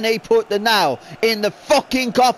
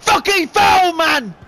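furious shout; 8 semitones higher, 4 dB louder, slower than usual. Real, not acted. Gamer furious at missed foul.

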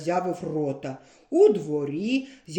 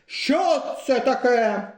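несамовитий крик; 4 semitones higher, 4 dB louder, slower than usual. A narrator's reading. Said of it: "закричав він несамовито".

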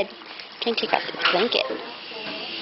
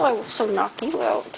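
usual speaking voice

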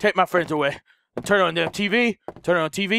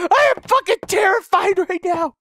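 real terror during the chase; terrified scream; 9 semitones higher than usual. Real, not acted. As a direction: shriek out the fear. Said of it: "I'm so scared".

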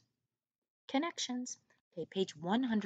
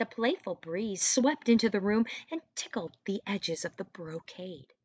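plain reading voice

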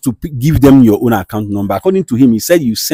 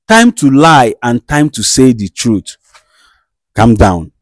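normal talking voice

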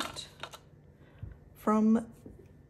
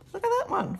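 surprised; 12 semitones higher, 3 dB louder, faster than usual. Real, not acted. Spots a damaged banknote.

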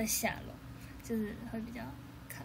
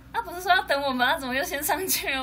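louder, higher mock grievance; indignant complaint; 5 semitones higher, 8 dB louder than usual. Members retell being left by the lift, loud mock complaint. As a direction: whine that they were left behind.

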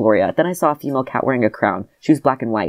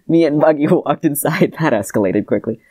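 usual speaking voice

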